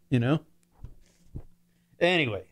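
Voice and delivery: normal talking voice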